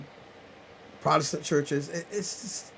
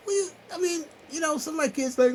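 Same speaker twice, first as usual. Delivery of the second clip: crying; 12 semitones higher, faster than usual. Real, not acted. Still crying mid-thought.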